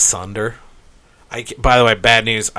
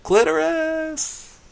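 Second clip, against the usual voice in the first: mock yell; 5 dB quieter, much slower than usual. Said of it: "I just wanna yell clitoris".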